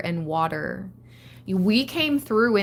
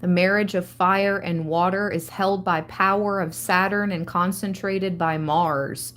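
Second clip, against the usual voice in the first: normal talking voice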